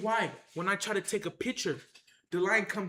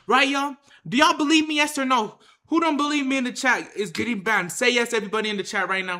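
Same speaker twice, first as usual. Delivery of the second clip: calling out to chat; 8 dB louder than usual. Real, not acted. Demanding the chat believe him.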